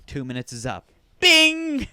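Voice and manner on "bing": high, loud imitated timer bell; microwave ding. Announcing the two minutes are up, loud imitated ding.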